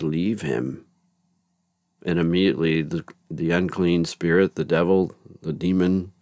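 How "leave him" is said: soft, understated retold command; very calm. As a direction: quote a quiet, unhurried order. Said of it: "just very calmly says".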